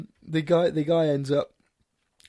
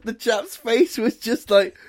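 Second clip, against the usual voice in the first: ordinary delivery, nothing marked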